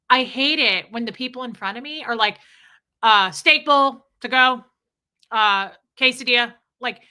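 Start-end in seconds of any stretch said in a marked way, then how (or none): 3.0-6.5 mock curt customer, much louder; rude customer order. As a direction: call out the order without any greeting. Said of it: "People shout at them all day".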